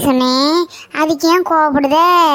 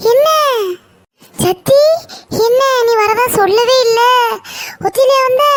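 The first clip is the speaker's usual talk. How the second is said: higher, delighted surprised welcome; excited, raised voice of a pleased aunt; surprised greeting; 7 semitones higher than usual. Acted. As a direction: greet her with happy surprise. Surprised to see her arrive.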